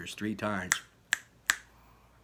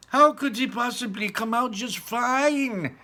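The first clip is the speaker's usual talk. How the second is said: high, loud slow demand; high loud slow fretful mother; distraught mother; 13 semitones higher, 8 dB louder, slower than usual. Acted. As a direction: plead in disbelief.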